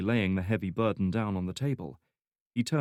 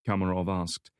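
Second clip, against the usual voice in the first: usual speaking voice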